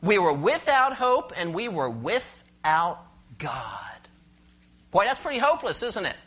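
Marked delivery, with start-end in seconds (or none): 1.4-4.1 slow, hushed whisper for effect; dramatic whisper. Acted. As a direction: let it sink to a slow whisper. Preacher drops to dramatic whisper.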